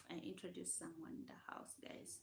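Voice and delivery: soft breathy whisper. Real, not acted. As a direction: whisper it to the viewers. Whispered repeat of the ask.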